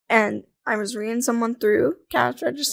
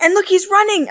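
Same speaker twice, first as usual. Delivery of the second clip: high, louder excited pointing-out; mock excitement; 8 semitones higher, 5 dB louder than usual. To boyfriend about the runner on the beach, excited. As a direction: retell it as a thrilled discovery. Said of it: "you get excited for some weird reason".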